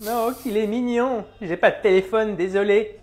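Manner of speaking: put-on cooing old-woman voice, starting high and slow; flattered old dear; old lady. Old woman asked for her number, old-lady voice. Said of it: "c'est un vieux crouton".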